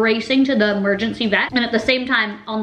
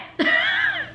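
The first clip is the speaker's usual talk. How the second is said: high squeal laugh. Real, not acted. Laughs while eating.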